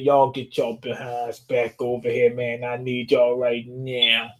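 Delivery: a hint of a bossy mother calling her kids back; low, unhurried mock order to come here; lightly voiced Big Mom. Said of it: "She said".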